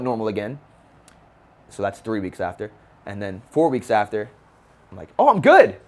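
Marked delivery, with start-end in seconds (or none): 5.2-5.8 high, loud burst of overconfidence; excited and cocky. Retold or imitated. Recovering teen tells himself he's fine, excited shout. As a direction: crow it like you're fully healed.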